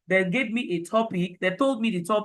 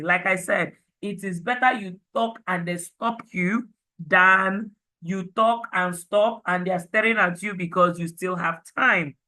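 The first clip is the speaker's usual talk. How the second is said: usual speaking voice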